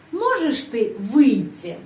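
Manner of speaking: slow question, weight on "Можешь"; intonation stress demo. Shown as a demonstration. Teacher shows the intonation of wanting someone out, stressed "Можешь". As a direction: stretch and stress "Можешь", then fall. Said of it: "Penekanan intonasi pada kata можешь".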